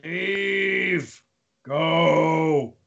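long drawn-out demon command; booming demon lord. Acted. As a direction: order them out like a demon lord. GM voices demon banishing players.

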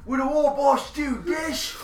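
high shouted boast; defiant shout. Acted. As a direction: yell it back at the orcs. Player yells back at orcs.

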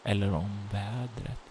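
soft narration with long pauses; phrase by phrase, hushed and suspenseful; game master's ominous narration. A narrator's reading. Game master's closing scene setting.

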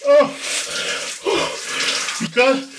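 yelping, gasping under icy water; high, breathless shock at the cold; cold shower shock. Real, not acted.

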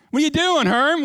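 high, loud call from the yard; calling friend. Retold or imitated. Boys outside calling Herm to play, high loud call. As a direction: kids yelling for him to come play.

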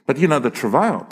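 ordinary delivery

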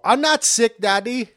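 put-on high, loud little-kid protest; mock indignant kid shout; young son. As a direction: imitate a child yelling from the back room. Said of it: "he'd hear me in the back and go".

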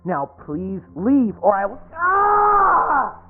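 slow plea breaking into shriek; pained scream. Acted. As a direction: plead then shriek in pain. Pleading then burned.

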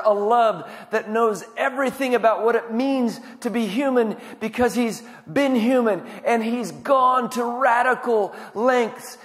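soaring pulpit proclamation; fervent preaching. Acted. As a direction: swell into a passionate climax. Preaching at full force.

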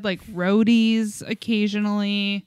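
ordinary talk

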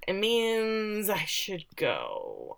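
very slow, stretched-out and soft; reluctant hedging. Retold or imitated. Young man finding an excuse to leave, slow reluctant hedge. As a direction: drag the words out awkwardly, softly. Awkward morning-after exit, acted.

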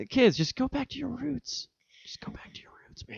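put-on soft whisper to the kids; breathy hushed advice.

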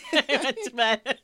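laughing through the words. Real, not acted. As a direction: say it while cracking up. Laughing at own story.

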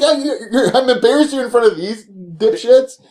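half-laughing smart-ass comeback; mock retort. Roommate mocking Eric's embarrassment, high and laughing. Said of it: "It's like".

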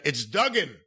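correcting people, "Duggan" with a short uh vowel; Duggan pronunciation. Retold or imitated. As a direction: say "Duggan" to rhyme with "rug in". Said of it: "turned around and said".

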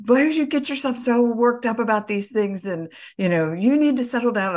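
a hint of a scolding mom; mom's scolding. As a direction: lecture me like my mother. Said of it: "she was like".

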